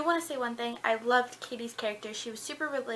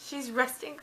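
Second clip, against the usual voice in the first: ordinary talk, nothing marked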